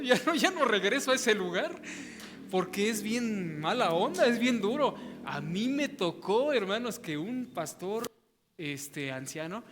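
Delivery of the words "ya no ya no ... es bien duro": loud, laughing mock complaint, higher; laughing mock protest. Churchgoer swearing never to come back, laughing and loud. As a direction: blurt it out through laughter, half joking. Imagined member refusing to return.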